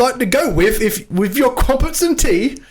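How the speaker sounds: high, halting mock-British accent. Host teasing about crumpets and tea, hammed-up British accent. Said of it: "my British, British accent".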